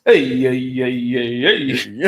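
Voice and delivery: mock grandfather saying. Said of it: "It goes".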